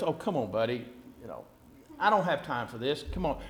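low, muttered brush-off; mock impatient agent. Talent agent to dog owner, mock weary impatience. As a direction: dismiss them under your breath, softly. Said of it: "the talent agent goes".

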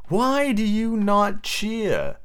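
high, drawn-out indignant demand; said hotly. A narrator's reading. Peter demanding cheers from the boys, hotly. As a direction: stretch it out in a grand put-on voice. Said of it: "he said hotly".

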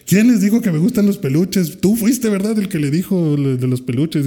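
high, accusing mock yell; retold outburst, high pitch easing toward the end; Piccolo yelling. Piccolo accusing someone about the plushies, mock yelling. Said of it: "le empieza a gritar".

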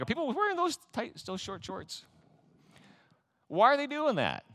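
incredulous. Retold or imitated. Pastor mocking runners' tiny shorts, high and incredulous. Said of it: "I'm like".

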